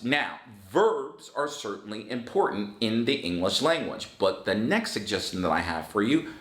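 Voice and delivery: ordinary talk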